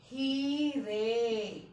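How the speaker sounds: हीरे very slow, no nasal at the end; slow plain plural. Shown as a demonstration. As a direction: stretch हीरे, no bindi. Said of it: "ऐसे ही". (हीरे धीरे, बिना बिंदी)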